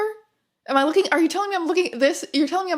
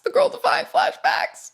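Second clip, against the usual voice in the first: pitched way up, mock horror; high squeaky voice; 13 semitones higher than usual. Repeated line, pitched high.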